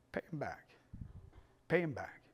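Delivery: very soft, spare delivery; imitated mentor's low-key counsel; hushed advice. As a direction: let it fall quietly, with a long pause. Quoting his mentor's quiet advice.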